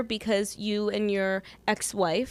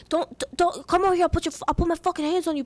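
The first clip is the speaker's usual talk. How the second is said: high, stammering New York tough-guy imitation; mock threat in New York accent, tumbling out; heated New York threat; 8 semitones higher than usual. Woman retells street fight, heated mock New York. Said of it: "getting heated up".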